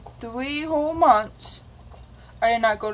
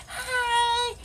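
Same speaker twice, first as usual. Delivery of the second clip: bright, much higher chirpy hi; cheery greeting; 12 semitones higher, 4 dB quieter than usual. Shown as a demonstration. Girl showing how happy she used to greet people, beaming. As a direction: sing out a happy hello. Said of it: "I'd be like".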